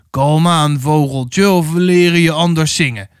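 rough order from the gang leader; snarling threat. A narrator's reading. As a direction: threaten her with a mild snarl. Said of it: "snauwde de oudste". (gesnauwd dreigement)

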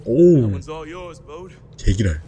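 startled curse. Real, not acted. Hit in game, swears.

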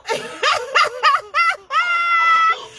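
high, crying-with-laughter shriek. Real, not acted. Watching the enemy's downfall, a shrieking laugh. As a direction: let the laugh wail out.

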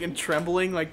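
ordinary talk, nothing marked

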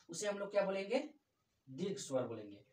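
ordinary talk, nothing marked

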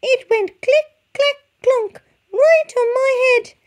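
squeaky high, loud chick voice; a tiny chick in a flap; panicked chick. A narrator's reading. Panicked chick telling the hen.

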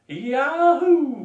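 September whooping in triumph; bellowed. A narrator's reading. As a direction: bellow it out with joy. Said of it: "bellowed September".

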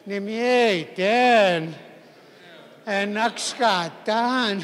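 slow, drawn-out Cree accent. Shown as a demonstration. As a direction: stretch each word out with a Cree accent. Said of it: "say it with Cree accent".

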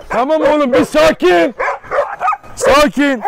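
loud, slow, high command to settle the dogs; firm shout to calm. Real, not acted. Dogs fighting, calming them. (yüksek sesle, yavaş ve sert bir sakinleştirme)

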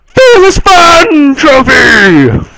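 high, loud, slow drawn-out cheer; hammed-up triumphant call; mock announcer shout. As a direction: proclaim it like a prize. Announces trophy unlocked.

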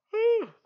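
loud, high yawn; big yawn. Real, not acted. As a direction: yawn out loud, late at night. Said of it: "I'm yawning".

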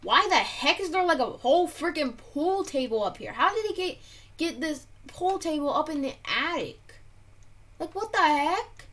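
high, loud amazed outburst; disbelieving, near shouting; loud disbelief. Real, not acted. Finds pool table in attic.

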